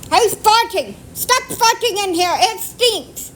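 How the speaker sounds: high, loud call-out; shouting. Real, not acted. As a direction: yell across the room in disgust. Calls out at farting kids.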